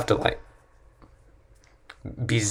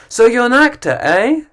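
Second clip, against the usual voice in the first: mock teasing, pitched up; pushy stranger; 10 semitones higher, 4 dB louder than usual. Stranger pestering an actor, mild mocking tone. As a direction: needle the actor. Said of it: "with people in any other field".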